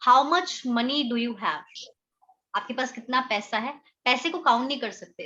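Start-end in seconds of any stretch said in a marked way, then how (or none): 0.1-1.6 slow, deliberate example sentences; measured classroom dictation; slow teaching read. Shown as a demonstration. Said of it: "simple सा sentence बनाकर दिया है".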